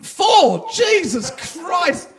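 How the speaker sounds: high, loud, drawn-out disbelief; shocked shout. Real, not acted. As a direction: blurt it out in total shock. Shocked at four kids.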